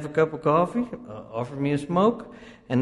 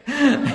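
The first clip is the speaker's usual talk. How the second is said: burst of laughter; 12 semitones higher, 6 dB louder than usual. Real, not acted. Punchline of locked door.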